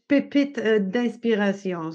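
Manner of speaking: pépites d'inspiration said in French; pépites d'inspiration with French vowels and uvular r; French pronunciation. Shown as a demonstration. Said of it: "I would say in French".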